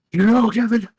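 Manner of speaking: put-on high, loud French Canadian accent; Sami Zayn impression. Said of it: "he has a strong accent".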